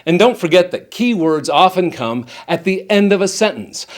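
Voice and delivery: ordinary delivery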